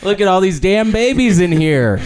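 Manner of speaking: high startled exclamation; mock-panic about babies; mock alarm.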